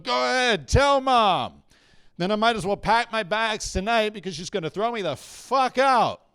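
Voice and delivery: put-on high, loud drunken yell back; acted yell, building to a blast on the last words; yelling dad. Drunk dad to child mid-pee, acted yelling. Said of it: "he's yelling at me going".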